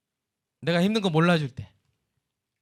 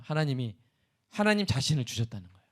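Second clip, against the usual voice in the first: ordinary delivery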